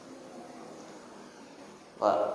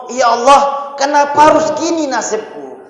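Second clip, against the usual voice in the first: high, louder cry of complaint to God; disappointed lament; 6 semitones higher, 5 dB louder than usual. Retold or imitated. Preacher imitating a disappointed believer, high lament. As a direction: wail it up to the heavens in dismay. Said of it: "langsung ngomong".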